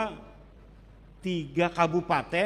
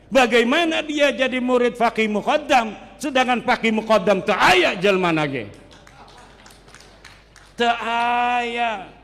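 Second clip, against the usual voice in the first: louder and higher, a pointed rhetorical demand; indignant challenge; 4 semitones higher, 5 dB louder than usual. Real, not acted. Preacher demanding proof from the congregation, indignant and loud. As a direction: press the question hard at the crowd.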